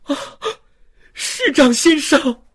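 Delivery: high, loud cry of alarm; Fantine crying out. A narrator's reading. Fantine calling to the mayor, crying out. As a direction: cry out for help. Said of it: "方婷叫道".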